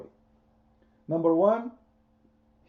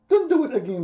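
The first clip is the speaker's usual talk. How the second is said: mock Allah warning; 10 semitones higher, 6 dB louder than usual. Allah warns Muhammad.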